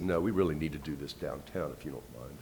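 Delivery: imitated detective, low and soft; understated official request. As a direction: drop the voice for a quiet, firm request. Detective declining invitation, retold.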